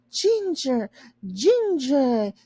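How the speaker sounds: a son crying out a sudden clue; excited cry. A narrator's reading. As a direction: call it out excitedly. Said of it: "Yorick cried".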